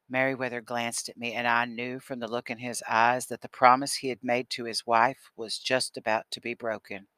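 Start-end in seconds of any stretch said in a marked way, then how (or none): none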